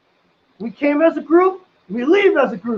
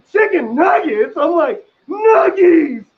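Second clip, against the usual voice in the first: loud, higher squeal of delight; excited kid; 5 semitones higher, 5 dB louder, slower than usual. Retold or imitated. Narrator thrilled at the chicken nuggets, loud childish squeal. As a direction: shout it like a kid seeing treats.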